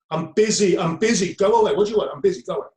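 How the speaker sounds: loud, impatient brush-off; dismissive mum. Retold or imitated. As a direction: shoo the child away, too busy to care. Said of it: "And the mum goes".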